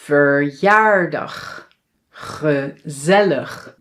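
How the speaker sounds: VERJAARDAG and GEZELLIG slowly, first E as uh; schwa at the beginning. Shown as a demonstration. Teacher shows schwa in first syllables, slow careful syllables. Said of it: "It can also be at the beginning".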